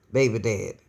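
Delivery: ordinary delivery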